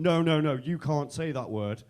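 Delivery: loud "No, no, no", then slow reproach; insistent mock scolding. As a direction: cut in loudly, then spell out the ban slowly. Girl scolds him more firmly.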